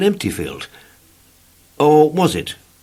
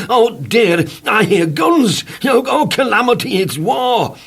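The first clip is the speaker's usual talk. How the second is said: panicky cry; 12 semitones higher, 4 dB louder than usual. Acted. Mr Jelly panics at noises.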